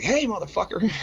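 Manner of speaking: high-pitched confrontational call-out; retold angry call. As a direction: call after him, spoiling for a fight. Retells calling out the driver.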